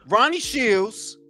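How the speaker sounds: usual speaking voice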